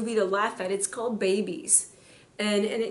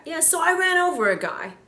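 higher, louder offhand quote; casual confession; 7 semitones higher, 4 dB louder than usual. Retold or imitated. Pastor replaying the brother's shocking opener, higher and louder. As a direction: toss it off like it's nothing. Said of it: "starts off a story like that".

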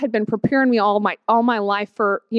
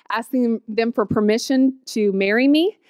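ordinary delivery

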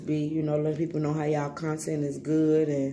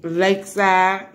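loud, stretched-out name; shout-out call; 3 semitones higher, 7 dB louder, slower than usual. Real, not acted. Calling out a name in shout-outs.

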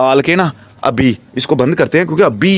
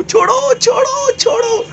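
much higher, sharp squeals of let go; squealing let go; slower than usual. Real, not acted. Pleading with the biting budgie, high squeals. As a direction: yelp at the bird to let go of the finger.

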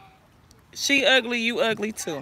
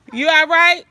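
loud, higher-pitched parental call; 6 semitones higher, 7 dB louder than usual. Real, not acted. Calling out to children nearby.

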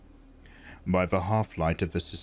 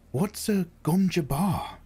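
put-on higher, wondering boy; curious boy voice; 10 semitones higher than usual. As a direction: ask it like a puzzled child. Boy's inner question.

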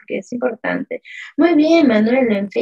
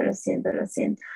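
muttered apology; 6 dB quieter, faster than usual. Real, not acted. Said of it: "perdón, lo siento".

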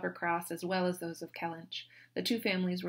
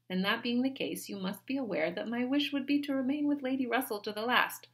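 Anne reasoning; 7 semitones higher than usual. A narrator's reading. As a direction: remind her gently but firmly.

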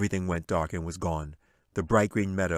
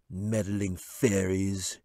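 slow groaned wizard line; groaning wizard; much slower than usual. A narrator's reading. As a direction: moan it out, fed up. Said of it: "he groaned".

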